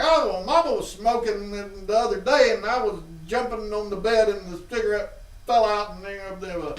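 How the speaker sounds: high, put-on innocent kid voice; innocent kid lying. Boy spinning a lie to his parents, high and innocent. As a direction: fib sweetly like a caught kid.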